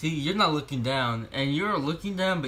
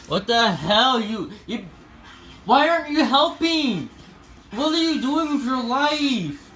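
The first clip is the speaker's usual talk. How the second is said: loud, high exasperated scolding; shouting at the cat in disbelief; 8 semitones higher, 5 dB louder than usual. Real, not acted. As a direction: yell at the cat for just sitting there.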